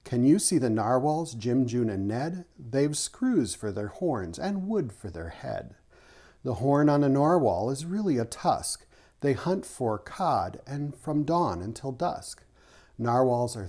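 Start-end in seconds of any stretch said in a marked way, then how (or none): none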